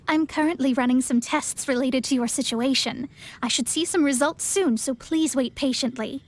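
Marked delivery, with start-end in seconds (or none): none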